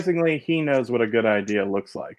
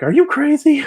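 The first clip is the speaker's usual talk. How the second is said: high, loud disbelieving squeal; mock shriek of disbelief, much higher; shocked brother; 4 dB louder than usual. Brother hearing the news, a hammed-up high incredulous yelp. Brother scolding in retold scene.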